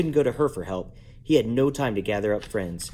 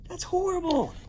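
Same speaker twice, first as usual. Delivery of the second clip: high mock-horrified aside; horrified comment. Real, not acted. Reader comments on the story, high mock horror. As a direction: squeal it as an aside. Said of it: "reader reacts to story".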